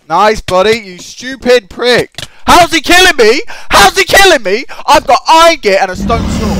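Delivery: sarcasm blowing up into high, loud yelling; outraged shouting. Real, not acted. Gamer getting killed despite better gear, outraged yelling. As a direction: yell in disbelief as you lose.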